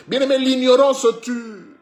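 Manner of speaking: high, very loud pulpit shouting; full-force preaching. Acted. Preacher exhorting the beloved to refuse ignorance, full-force preaching. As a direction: cry it out like an urgent warning to the flock.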